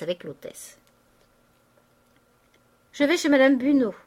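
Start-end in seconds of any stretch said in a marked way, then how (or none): 2.9-3.9 raised, menacing announcement; threatening. A narrator's reading. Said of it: "dit-elle d'un air menaçant".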